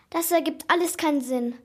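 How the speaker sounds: plain reading voice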